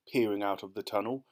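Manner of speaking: plain reading voice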